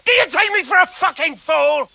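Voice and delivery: angry outburst. Acted. Toth rejects the hostage trade, furious outburst. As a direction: spit the insult back at him. Toth refuses the exchange, enraged.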